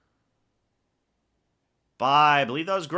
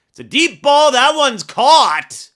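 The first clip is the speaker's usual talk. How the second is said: sudden loud, high play-by-play burst; excited call; 10 semitones higher, 12 dB louder than usual. Real, not acted. Big deep pass completed live.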